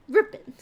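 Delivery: "Ripping" punched loud and high; sarcastic loud repeat; mocking punchy echo. Real, not acted. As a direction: spit the word back at him. Echoes his brag mockingly.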